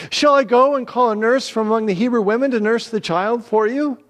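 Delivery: put-on high, loud girlish voice; young sister's voice. A narrator's reading. Sister eagerly offering Pharaoh's daughter a nurse, high girlish voice. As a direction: pitch it way up like an eager young girl.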